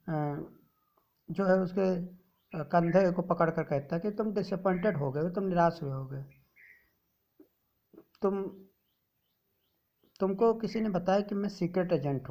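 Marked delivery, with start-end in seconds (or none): none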